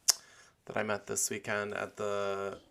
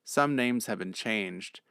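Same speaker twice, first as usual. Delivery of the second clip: brisk formal disclaimer voice; steady announcer read. Acted. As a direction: read it like a legal notice.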